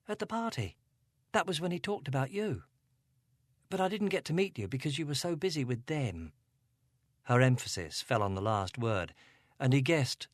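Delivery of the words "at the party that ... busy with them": put-on higher, upset young girl voice; Angela, a hint of upset teen; teenage girl. A narrator's reading. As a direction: voice her as a troubled teenage girl.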